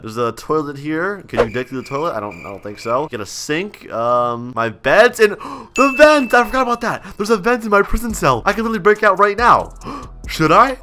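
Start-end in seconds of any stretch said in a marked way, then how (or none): 5.3-10.8 high-pitched, gasping excitement; excited discovery. Pretend. Inmate spotting a vent in his cell, excited. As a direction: gasp and let the pitch leap up.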